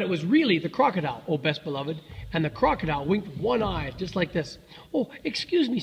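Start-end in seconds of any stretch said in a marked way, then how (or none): 4.9-5.8 higher-pitched, polite little elephant; very polite child. A narrator's reading. Elephant's child addressing the crocodile, very polite. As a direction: excuse yourself most politely in a raised voice. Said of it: "said the elephant's child most politely".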